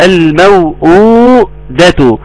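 "الموءودة" slow, with the oo lengthened; long waw. Shown as a demonstration. Teacher explaining the unwritten waw, long waw. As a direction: hold the second waw of "الموءودة" two beats. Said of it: "واو مادية تبدد مقدار حركتين".

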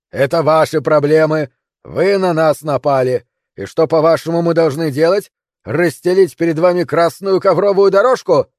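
loud, indignant sarcasm; shouted retort. Acted. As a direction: yell down at the intruders. Defender shouts back in quarrel. (язвительный крик в ответ)

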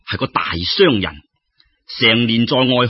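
ordinary delivery, nothing marked

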